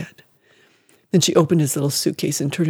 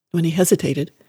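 usual speaking voice

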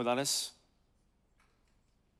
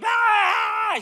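very high, loud mock shriek; imitated terrified scream; 7 dB louder than usual. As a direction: scream like a child panicking on a roller coaster. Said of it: "like, screaming her head off".

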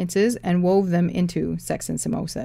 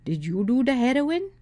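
put-on Indian accent; 7 semitones higher than usual. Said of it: "ones with thick accents and head bobbing".

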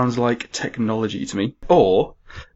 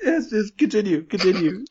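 laughing; 9 semitones higher than usual. Real, not acted. Still laughing after coming back.

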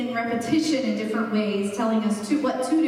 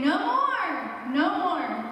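drawn-out, higher first "no more", second falling; fed-up father; 5 semitones higher, much slower than usual. Retold or imitated. Dad to a child getting on his nerves, fed-up emphasis. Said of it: "getting on his dad's nerves".

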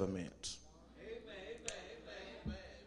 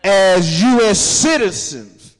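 loud, higher, fervent preaching; preaching at full force; 6 semitones higher, 12 dB louder than usual. Acted. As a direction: preach it hard to the congregation.

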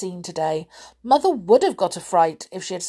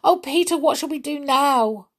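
near tears; 8 semitones higher, 3 dB louder than usual. A narrator's reading. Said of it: "almost in tears".

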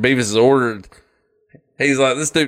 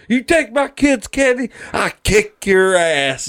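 higher, strained cartoon dad voice, slowing into the threat; mock angry dad; 9 semitones higher than usual. Dad at the door threatens the candy thieves, in a high, slow mock threat.

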